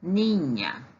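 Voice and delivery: "niña" with a clear Spanish ñ; Spanish pronunciation. Shown as a demonstration. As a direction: model the word "niña" carefully. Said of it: "Menina se diz".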